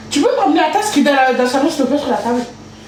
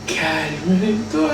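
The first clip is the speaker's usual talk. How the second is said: lower, softer and slow; calm yourself; 7 semitones lower, 5 dB quieter, slower than usual. Real, not acted. Telling herself to calm down, soft and slow. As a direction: talk yourself down gently. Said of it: "Il faut que je me calme".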